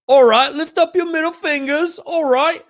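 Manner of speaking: high-pitched put-on British accent. Singer addressing the crowd, high British accent. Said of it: "I think I could do a better [name]".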